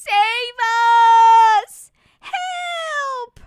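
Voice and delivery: high panicked cries; kids crying for help. Acted. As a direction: scream for rescue like a child.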